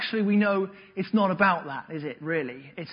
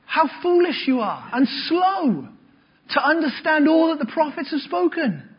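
louder, higher, forceful rebuke; bold rebuke; 8 semitones higher, 6 dB louder than usual. Shown as a demonstration. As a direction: declare it like a firm rebuke. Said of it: "Just imagine. Huh?".

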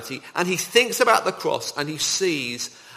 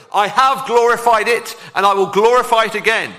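booming, louder divine proclamation; voice from heaven; 6 semitones higher, 7 dB louder than usual. Acted. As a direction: proclaim it like God from the sky. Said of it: "Then a voice came from heaven".

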